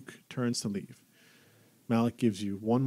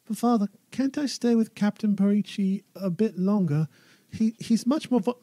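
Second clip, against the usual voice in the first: put-on pleading boy voice; 11 semitones higher, 5 dB louder than usual. Son pleading with father.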